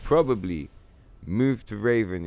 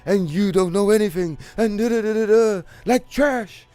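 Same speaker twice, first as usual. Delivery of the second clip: much higher, louder put-on girly voice, gushing; squeaky mock praise that climbs ever higher; mocking gushing fan; 10 semitones higher, 5 dB louder than usual. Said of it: "that's how she was talking him up".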